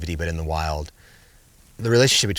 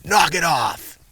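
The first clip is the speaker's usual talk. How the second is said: sharp command; 9 semitones higher, 6 dB louder, much slower than usual. Retold or imitated. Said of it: "I said".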